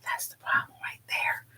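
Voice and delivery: secret whisper leaned in close. Retold or imitated. Leaning over in the truck to whisper to the driver. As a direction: breathe it like a shared secret. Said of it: "leaning over and going".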